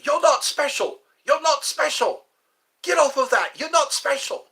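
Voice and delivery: loud, high-pitched imitation of a British policeman; bobby cop scolding. Bobby telling people to get off, scolding shout. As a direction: tell them off like an indignant bobby. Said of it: "watch the Bobby Cops when they say".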